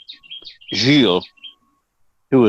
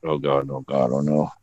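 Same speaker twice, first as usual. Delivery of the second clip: inner dread; 9 semitones lower, 6 dB quieter than usual. Retold or imitated. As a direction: lower and softer, sinking dread. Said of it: "I thought".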